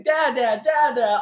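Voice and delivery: a lightly voiced excited daughter calling; a hint of an excited toddler; excited child.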